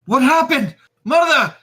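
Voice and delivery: high, loud panicked cry; desperate shout. Acted. Son finding his mother on the floor, calling out high and loud.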